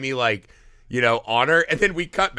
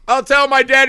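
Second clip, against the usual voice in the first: high, loud mock tantrum; spoiled son's threat; 10 semitones higher, 9 dB louder than usual. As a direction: protest like a bratty rich son.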